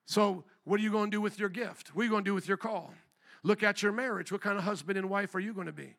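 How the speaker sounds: ordinary talk, nothing marked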